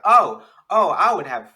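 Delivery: loud, delighted mock realisation; pleasantly surprised reaction. Acted reaction to hearing his English.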